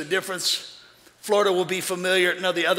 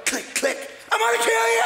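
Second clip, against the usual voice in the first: mock threat; 13 semitones higher than usual. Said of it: "I go".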